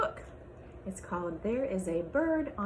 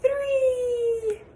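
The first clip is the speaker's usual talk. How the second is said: count's last number rises into a high, louder wail; alarmed wail; 9 dB louder than usual. Acted. Third egg appears, counting.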